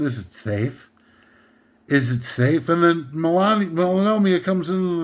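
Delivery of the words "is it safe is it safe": put-on repeated probing question, lightly accented; Laurence Olivier. Quoting the dentist-chair torturer, low imitated European accent. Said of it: "keeps asking him over and over again".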